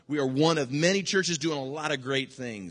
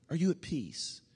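softer question; 7 dB quieter than usual. Shown as a demonstration.

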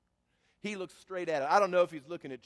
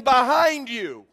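loud, slow warning call; urgent call; 5 semitones higher, 11 dB louder, slower than usual. Pretend. Preacher calling the world to look behind, loud and slow. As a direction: call the world to turn around.

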